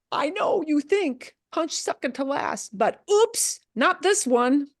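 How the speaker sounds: high, teasing correction; playful explainer voice. Acted. Voicing the accent mark's message.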